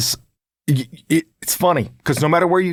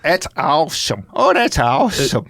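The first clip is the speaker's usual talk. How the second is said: drawn-out, gushing impersonation; mother-in-law's catchphrase; 2 semitones higher, slower than usual. Retold or imitated. Teasing the mother-in-law's favourite word, drawn-out impersonation. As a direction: imitate her slow, stretched catchphrase. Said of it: "her word is awesome".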